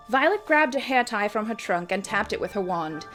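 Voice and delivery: plain reading voice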